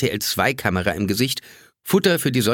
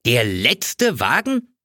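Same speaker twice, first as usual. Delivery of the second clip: slow, stretched-out dismayed cry; exclaimed; much slower than usual. A narrator's reading. Stranded narrator cries out at the counter, dismayed. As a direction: disbelief at losing the last car. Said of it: "rief ich aus".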